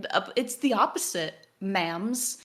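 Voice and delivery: incredulous defiant retort; exasperated retort. Retold or imitated. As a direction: correct them with mounting exasperation, loud and high. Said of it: "And I'm like".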